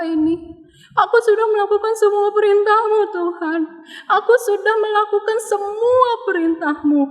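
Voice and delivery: high, pleading, tearful cry to God; crying prayer. Acted. Sick King Hizkia begs God for his life, high tearful wailing. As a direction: wail it out like a desperate king begging. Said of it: "Dia nangis dan dia berdoa".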